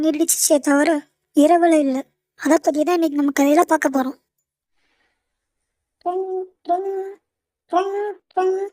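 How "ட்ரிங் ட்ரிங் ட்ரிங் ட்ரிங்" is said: soft, slow vocal ring-ring of a telephone; தொலைபேசி ஒலி போலி; phone ring imitation. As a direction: hum the phone ringing softly, a bit higher.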